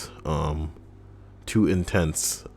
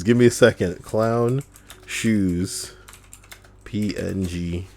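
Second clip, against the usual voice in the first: ordinary talk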